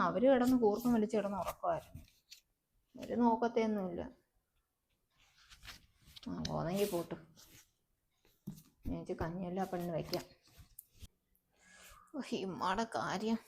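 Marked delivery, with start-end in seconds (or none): 6.3-13.4 muttered aside. Acted. Muttering to herself alone.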